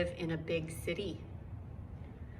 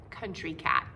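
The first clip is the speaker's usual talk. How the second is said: Country Cat dropped low and falling; low title read; 5 semitones lower than usual. Shown as a demonstration. Title repeated with contrast.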